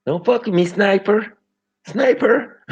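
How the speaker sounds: high, loud hammed-up threat; mock gangster. Joking about Born Rich's tooth gap, mock gangster threat. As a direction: put on a silly tough-guy voice. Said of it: "Imagine Extendo out of your teeth".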